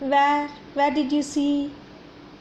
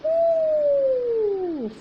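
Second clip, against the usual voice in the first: long high howl; long fox howl for kids; 12 semitones higher than usual. Shown as a demonstration. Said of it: "Fox sound name is howls".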